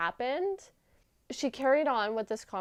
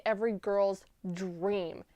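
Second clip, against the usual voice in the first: usual speaking voice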